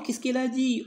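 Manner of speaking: ordinary delivery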